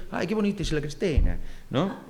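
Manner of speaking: playful mock Galician tune; Galician accent. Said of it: "hablaban así porque eran gallegos".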